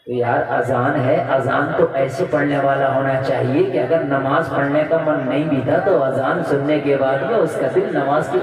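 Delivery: low, measured sermon voice; deep, deliberate exhortation; earnest low preaching. Real, not acted. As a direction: bring the pitch right down and explain solemnly. Sermon point in dropped voice.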